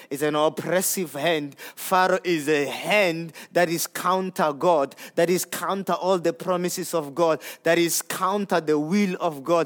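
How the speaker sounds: ordinary delivery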